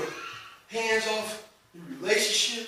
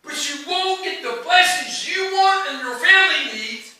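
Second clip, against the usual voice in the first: much higher, louder preaching build; preaching at full force; 9 semitones higher, 15 dB louder than usual. Real, not acted. Preacher building to a climax before the congregation, fervent. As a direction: drive the point home to the congregation.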